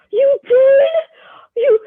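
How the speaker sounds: very high, squealing mock astonishment; overjoyed disbelief. Wrestling mentor hearing the athlete got her spot back, overjoyed. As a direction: imitate someone bursting with proud excitement. Retold mentor's joy at news.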